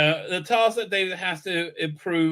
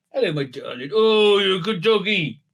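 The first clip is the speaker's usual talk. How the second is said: doting pet talk; 2 semitones higher than usual. Real, not acted. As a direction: fuss lovingly over a dog. Fusses over the dog.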